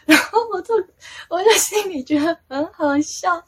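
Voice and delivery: high, loud, laughing through the words; amused laughing. Real, not acted. Recalling the god's teasing remark, laughing as she talks. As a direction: talk through real giggles.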